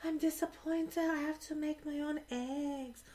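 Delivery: put-on high, soft whiny baby voice; insanely high voice. Cohost mocking the husband's complaints: high soft whine. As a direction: pout it out like a spoiled man-child. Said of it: "insanely high voice".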